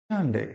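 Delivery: সানডে as the teacher's model, falling at the end; corrected Sunday. Shown as a demonstration. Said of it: "সঠিক উচ্চারণটা কি হবে দেখো". (সানডে-র সঠিক উচ্চারণ দেখানো)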